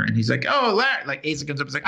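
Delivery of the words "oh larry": cheery call. Retold or imitated. Asa calling to Larry, retold.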